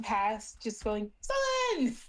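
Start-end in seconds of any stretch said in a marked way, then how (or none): 1.2-2.0 high-pitched call while zooming by. Acted. Amathea zipping past the door, calling for her son. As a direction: yell it as you fly past.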